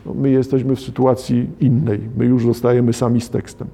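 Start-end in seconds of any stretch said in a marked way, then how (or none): none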